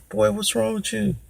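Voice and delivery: high-pitched mom voice; imitated mother. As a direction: question a crying son as his mother, way up high. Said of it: "my mother's like".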